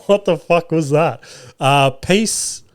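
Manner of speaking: ordinary talk, nothing marked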